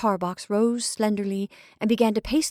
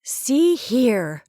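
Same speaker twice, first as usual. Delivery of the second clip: stern; 4 semitones higher, 5 dB louder than usual. A narrator's reading. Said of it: "said Marcia sternly".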